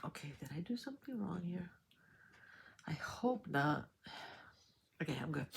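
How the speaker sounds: hushed unvoiced whisper to herself; whispering worriedly to herself over her count. Real, not acted. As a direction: whisper it while checking.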